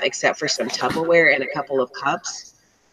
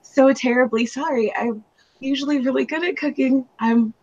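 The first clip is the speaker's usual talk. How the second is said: higher, over-apologetic put-on voice; mock groveling apology; 9 semitones higher than usual. Imagining apologizing for bad cooking, mock groveling. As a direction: ham up a flustered apology. Imitates her overly apologetic self.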